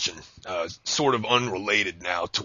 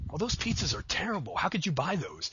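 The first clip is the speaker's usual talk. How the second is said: high, softer mock dismay; complaining date; 7 semitones higher, 5 dB quieter than usual. Host voices the date hating the pizzas, complaining. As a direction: imitate an appalled date, pitched up lightly. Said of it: "So I have to put".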